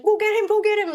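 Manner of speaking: high, loud, hurried command repeated; imitating the husband's rushed, loud urging. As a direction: push hard and fast, high and loud. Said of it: "You were just so rushed".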